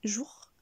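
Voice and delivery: JOUR with the sound going down; falling tune. Shown as a demonstration. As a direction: let jour drop like a downward arrow. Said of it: "le son descend".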